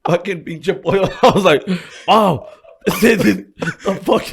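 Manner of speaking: high and loud, laughter breaking the words; can barely get it out for laughing; laughing while talking. Real, not acted. Telling a friend how mom ruined his chicken, cracking up.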